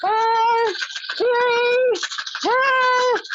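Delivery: high, loud shouted count; shouted countdown. Shown as a demonstration. Leader counts down loudly during the mad exercise. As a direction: yell out the count. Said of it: "yell about it".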